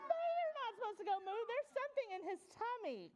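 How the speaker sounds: high-pitched playful indignation; mock alarm. Mock alarm at a mooing puppy.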